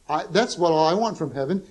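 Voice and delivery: normal talking voice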